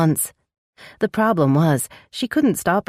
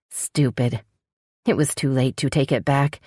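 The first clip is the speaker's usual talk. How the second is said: ordinary delivery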